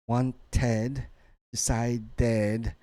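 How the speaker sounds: "wanted" and "decided" broken into beats with a full ed; ed as syllable. Shown as a demonstration. Teacher showing students a pattern, ed as syllable. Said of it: "The ed becomes a syllable".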